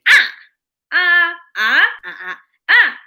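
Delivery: repeated "ah" shrieked high and falling, laughing through it; screamed falling tones. Acted. Frog story woman screaming falling tone, laughing. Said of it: "she was screaming".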